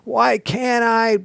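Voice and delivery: high, slow whiny protest; indignant, drawn-out objection; exclaimed why. Shown as a demonstration. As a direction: stretch out the complaint. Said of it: "should end with an exclamation point".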